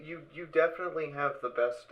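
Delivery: ordinary delivery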